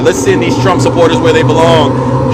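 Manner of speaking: damn them straight to hell with a mock preacher's roar; mock sentencing shout. Rallying the crowd to judgment.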